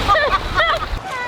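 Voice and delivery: high breathless laugh in cold water; high laughter. Real, not acted.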